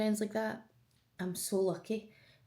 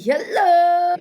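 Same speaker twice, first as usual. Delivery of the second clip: higher, louder hello; excited greeting; 10 semitones higher, 13 dB louder than usual. Real, not acted. Vlogger greeting her besties through the camera, excited. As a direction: sing out a big hello to your pals.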